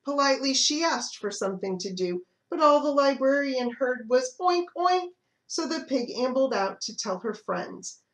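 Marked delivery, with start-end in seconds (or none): 4.4-5.1 higher snorting oink; playful pig oink. Acted. Pig noise heard by librarian.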